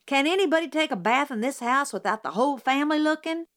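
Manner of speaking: fast, high indignant outburst. A narrator's reading. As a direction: blurt it out, fed up. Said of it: "provoked an outburst".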